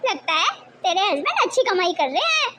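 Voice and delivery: ordinary talk, nothing marked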